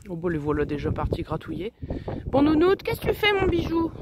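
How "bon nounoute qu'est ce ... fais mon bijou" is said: appel aigu et fort, très câlin; voix aiguë affectueuse. Real, not acted. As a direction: interpeller la poule comme un bébé. Calls her hen fondly. (voix de gâtifiage aiguë et forte)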